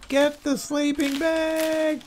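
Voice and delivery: high, drawn-out mock scream of viewers; mock yelling. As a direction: yell it like exasperated viewers, stretched and high-pitched. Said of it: "people were yelling at the screen saying".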